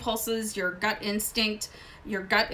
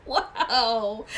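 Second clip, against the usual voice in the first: a surprised little laugh on the word; laughing wow. Real, not acted. Reader laughing at a grim spread, surprised. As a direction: laugh it out, caught off guard by the cards. Reacting to drawn tarot cards.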